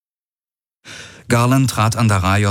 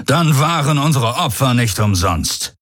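higher-pitched rallying cry; called out; 6 semitones higher than usual. A narrator's reading. Commander to his warriors, raised proclaiming call. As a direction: proclaim it to the troops. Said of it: "rief er".

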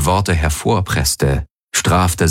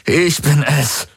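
high, slow, strained plea; desperate, labouring to speak; forced out; 9 semitones higher, slower than usual. A narrator's reading. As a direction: drag the words out with effort. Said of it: "brachte Garland hervor".

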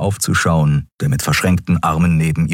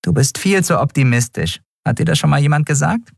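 viel höher und schnell, grinsend; neckende Nelen; 10 semitones higher, faster than usual. Acted. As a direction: frech aufziehen. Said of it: "Nelen grinste". (hoch und neckisch)

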